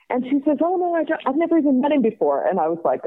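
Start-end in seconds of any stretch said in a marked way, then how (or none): 0.6-2.5 high, breezy imitation of a giddy friend; excited teenager. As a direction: brush off the doubt in a teen's high voice. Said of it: "like an excited teenager".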